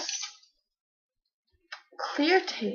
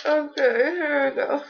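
ordinary talk

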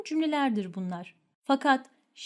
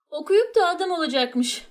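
louder, higher sneering scoff; mocking scorn; 5 semitones higher, 5 dB louder than usual. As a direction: sneer it out with contempt. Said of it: "Küçümseme cümleleri genellikle bir alay". (alaycı küçümseme)

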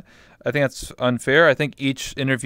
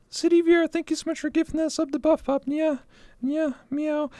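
squeaky cutesy anime voice; cat girl voice; 4 dB quieter than usual. Acted. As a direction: chirp it like a cute cat girl. Cute thank-you voice, ends meowing.

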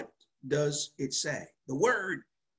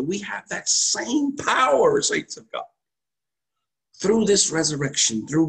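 preaching climax; 6 semitones higher, 7 dB louder than usual. Acted.

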